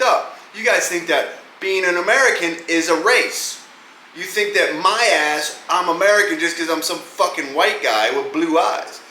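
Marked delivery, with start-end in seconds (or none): none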